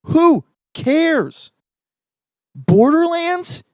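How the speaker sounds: high, loud and scoffing; exasperated outburst. Real, not acted. Ranting to viewers about the Borderlands movie cast, exasperated and scoffing. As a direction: throw the names away in disbelief.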